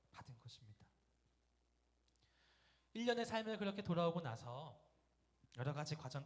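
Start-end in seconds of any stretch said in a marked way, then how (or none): 0.1-0.9 hushed breathless whisper. Real, not acted. Preacher whispering the climax of his testimony to the congregation. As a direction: let the words fall to a whisper.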